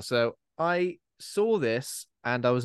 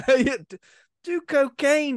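laughing along; 12 semitones higher, 4 dB louder, slower than usual. Retold or imitated. Echoing the mock dad line.

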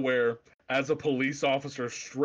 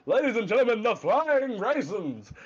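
mock announcer; 7 semitones higher than usual. Announcer voice in retold scene.

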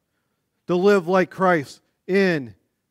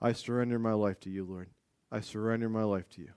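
much softer and lower, a hushed prayer; soft murmured prayer of surrender; humble surrender; 8 semitones lower, 10 dB quieter, faster than usual. Retold or imitated. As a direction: pray it quietly, giving yourself up. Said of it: "this attitude of".

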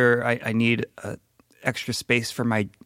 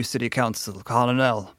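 ordinary delivery

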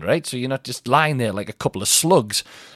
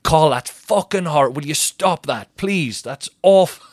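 higher, pleading mock outrage; appalled scolding; 4 semitones higher, 4 dB louder, slower than usual. Partner reacting to his crude question, appalled. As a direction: imitate an appalled partner begging him to stop.

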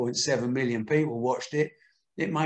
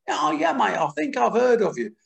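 higher, louder squeaky Aussie voice; mock a squeaky Australian boxer; squeaky Joe Bugner voice; 9 semitones higher, 5 dB louder than usual. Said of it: "a little squeaky voice from Joe Bugner".

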